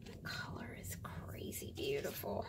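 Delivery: low, soft whisper; admiring whisper. Real, not acted. Crafter marvelling at a patina in a hushed whisper. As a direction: hush it in awe.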